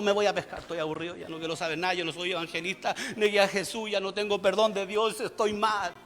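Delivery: low, soft, defeated acted voice; dejected Peter. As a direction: sink into a disheartened murmur. Acting Peter giving up.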